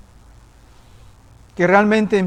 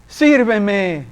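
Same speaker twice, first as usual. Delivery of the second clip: voice of the Lord; 2 semitones higher than usual. Retold or imitated. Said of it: "me dice".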